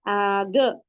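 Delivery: long held aa in आग, then g; stretched alif madd. Shown as a demonstration. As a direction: draw out the opening vowel of आग. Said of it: "इस तरीके से".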